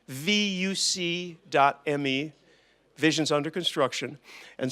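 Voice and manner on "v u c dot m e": V U C dot M E, each letter slow and separate; spelled out. Shown as a demonstration. Giving the conference web address to the room, slow and spelled.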